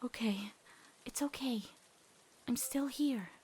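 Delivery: calm her down gently; soothing comfort. Acted. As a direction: girlfriend soothing a panicking Amity. Luz comforts sobbing Amity.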